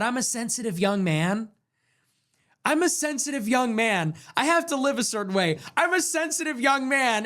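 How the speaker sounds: high, whiny-baby mockery; mock baby talk. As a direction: imitate a self-pitying sensitive young man. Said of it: "I'm talking like a baby".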